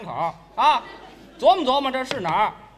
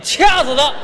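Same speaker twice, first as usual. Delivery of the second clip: mock shout; 7 dB louder than usual. 佯怒喊掐死他.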